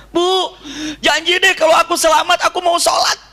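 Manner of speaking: high, loud pleading call; desperate cry. Retold or imitated. Boy shouts a vow to pray if saved. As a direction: beg for your life, calling out. Asal teriak aja.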